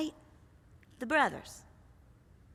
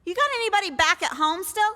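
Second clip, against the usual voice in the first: casual fishing question; 5 semitones higher, faster than usual. Acted. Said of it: "he's saying".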